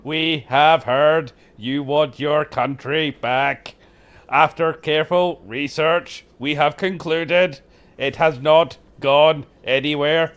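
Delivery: slow, loud, robotic Dalek delivery; hammed-up Dalek announcement. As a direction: grind each word out like a Dalek. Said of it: "and it was like".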